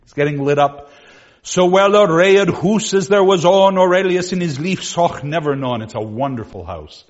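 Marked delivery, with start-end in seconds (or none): 1.4-5.8 put-on loud, rounded old vowels read aloud; Middle English reading. Shown as a demonstration. Teacher reading Chaucer's lines to the class in Middle English. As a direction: recite the old text like Chaucer. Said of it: "reading Middle English".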